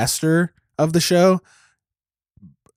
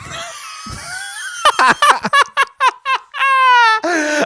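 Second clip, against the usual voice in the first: burst of laughter. Real, not acted. Absurd fifty dollar tier.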